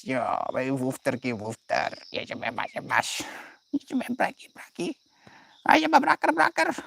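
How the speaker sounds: squeaky high pet-talk. Real, not acted. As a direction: coo at the puppy, high and light. Baby talk to the dog.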